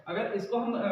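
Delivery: ordinary delivery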